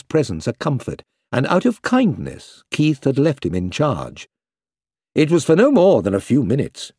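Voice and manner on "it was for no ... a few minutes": plain reading voice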